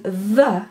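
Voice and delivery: the as an isolated buzzing th plus uh; the voiced between the teeth, set apart. Shown as a demonstration. Teacher naming the fricative, the as a voiced th sound. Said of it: "would be voiced interdental fricative".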